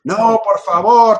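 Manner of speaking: high, loud, drawn-out plea; slow, imploring objection; pleading protest. Retold or imitated. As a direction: act out begging while haggling. Acts out haggling over the ransom.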